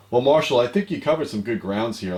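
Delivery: ordinary talk, nothing marked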